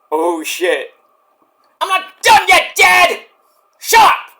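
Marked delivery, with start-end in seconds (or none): 1.8-4.3 much higher, louder mock teen yell; bratty teen daughter. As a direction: yell it like a furious teenage girl at dad. Shrill teen daughter impression.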